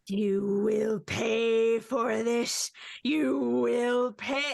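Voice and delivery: slow, drawn-out telepathic threat; seething spirit voice. Acted. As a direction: hiss a slow vengeful promise. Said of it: "And then his voice cracks a little bit".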